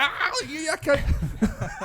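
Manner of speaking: high, slow jeer through laughter; laughing at him; laughing mockery. Kids laughing at neighbour.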